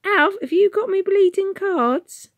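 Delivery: very high, loud, slow Cockney woman; yelled. Acted. Woman shouting to Alf in the raid, yelling. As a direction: bawl into the dark.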